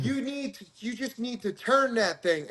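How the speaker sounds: retold high-voiced onlooker; imitating women competitors. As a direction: put on a high voice giving advice. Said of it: "two women, and they're like".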